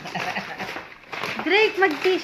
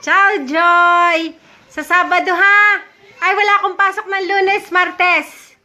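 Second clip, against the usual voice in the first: high, raised calling voice; shouting; 4 semitones higher, 4 dB louder than usual. Real, not acted. Talking to Joy who may not hear, shouting. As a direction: call out loudly to be heard. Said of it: "Ba't sumisigaw?".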